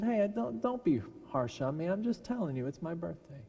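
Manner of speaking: plead, stung by the harsh words; hurt pleading. Retold or imitated.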